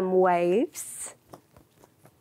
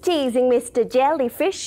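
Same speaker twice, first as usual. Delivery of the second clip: usual speaking voice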